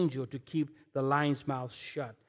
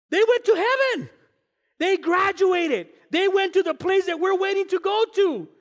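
loud, high, exultant declaration; triumphant proclamation; 13 semitones higher, 7 dB louder than usual. Acted. Pastor proclaiming martyrs went to heaven, loud exultant declaration. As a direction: shout it out as glorious news.

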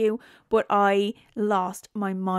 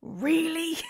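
incredulous; 7 semitones higher, 5 dB quieter than usual. Retold or imitated. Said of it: "And I'm like".

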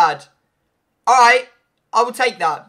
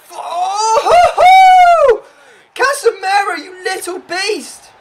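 screaming yes much higher and louder with a laugh; ecstatic shout; 11 semitones higher, 11 dB louder, much slower than usual. Real, not acted. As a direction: explode with joy. Celebrating a goal.

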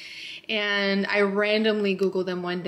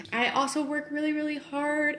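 about to cry; 6 semitones higher than usual. Real, not acted. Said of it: "I'm gonna start crying".

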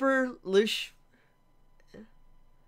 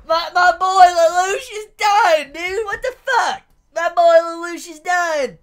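distraught wail; 5 semitones higher, 6 dB louder than usual. Real, not acted. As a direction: cry out in disbelief over losing your hero. Mourning favourite character's death.

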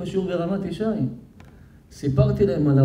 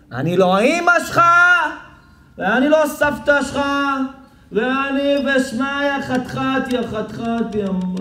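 mock angry wife; 6 semitones higher, slower than usual. Wife voiced scolding husband.

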